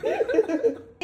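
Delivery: burst of laughter. Real, not acted. Laughing at the TV licence.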